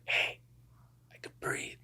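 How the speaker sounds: breathy whisper, barely voiced; retold whisper. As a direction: whisper it like you can hardly breathe. Retelling waking up in hospital.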